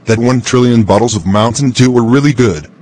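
usual speaking voice

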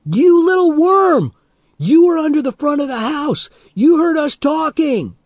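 very high, loud accusing yell; outraged high-pitched shout; terrible accusing cry. A narrator's reading. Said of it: "accusing voice".

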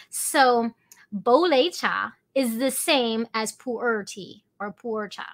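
ordinary delivery

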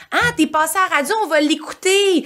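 high, fast, eager friend voice; mock excited friends. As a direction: play the overeager friends, quick and bright. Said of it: "les gens autour de toi".

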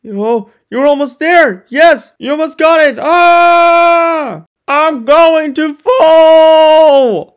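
frantic shouts ending in a long scream; rising panic into scream. Acted. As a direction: panic building to a terrified cry. Gordon about to fall.

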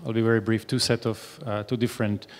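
normal talking voice

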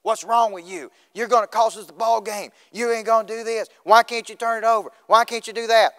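loud, high-pitched sideline tirade; yelling coach. Retold or imitated. Coach berating his turnover-prone point guard, yelling. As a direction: yell it like an exasperated basketball coach. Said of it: "My coach just kept yelling at me".

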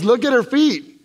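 high, loud amazed cry; a friend blurts out in amazement; excited exclaim. Retold or imitated. Friend points at healed feet, amazed and loud. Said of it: "her friend goes".

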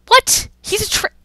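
very high, loud startled yelp; shocked. Real, not acted. Gamer spotting a traitor, shocked high yelp. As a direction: squeak it out high and cut off.

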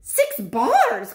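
put-on high, eager kid voice; high greedy little boy. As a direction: squeal it like a greedy child. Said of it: "called this greedy little swine".

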